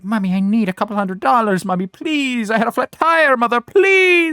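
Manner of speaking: high, rising mock plea to mommy; hammed-up whiny pleading; begging child. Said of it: "you gotta call your mommy".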